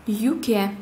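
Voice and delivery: «юке» with a fronted yü; teacher's demo of soft ю in «юке»; soft-word ю. Shown as a demonstration. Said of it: "а в мягких словах".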